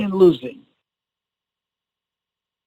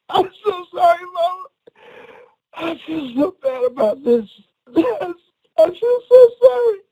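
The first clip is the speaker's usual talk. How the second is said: high, tearful re-enacted apology to mother; crying apology; 10 semitones higher than usual. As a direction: wail the apology, building to a squeak. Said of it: "So I was crying".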